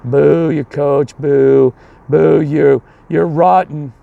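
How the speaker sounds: long, drawn-out loud boos; imitated booing. Angry parents jeering the winning coach, imitated booing. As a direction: jeer like a hostile crowd in the stands. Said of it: "just booing me the entire time".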